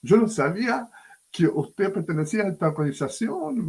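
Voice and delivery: high, emotional voice of the crying man; imitating a big man overcome with tears; retold tearful surprise. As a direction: pastor mimics the weeping convert meeting him again. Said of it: "y llorando, me dice".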